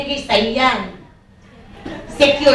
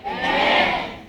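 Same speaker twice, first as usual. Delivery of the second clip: ordinary delivery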